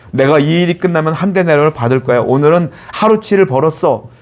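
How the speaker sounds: ordinary delivery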